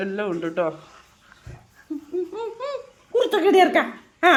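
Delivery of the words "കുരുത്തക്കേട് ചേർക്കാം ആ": laughing, high and loud; a real burst of laughter mid-line. Real, not acted. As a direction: laugh the words out. Laughing over the mischief.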